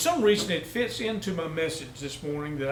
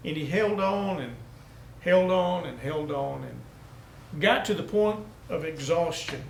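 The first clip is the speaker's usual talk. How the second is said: normal talking voice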